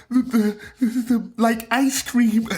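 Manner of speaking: high-pitched meme voice quote; quoting a meme. Retold or imitated. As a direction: squeak it out like the viral clip. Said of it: "so I start quoting the".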